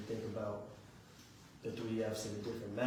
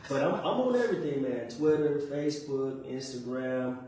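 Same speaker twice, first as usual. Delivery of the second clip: proud and loud; 4 semitones higher, 9 dB louder than usual. Real, not acted. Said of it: "Stick your chest out and tell them".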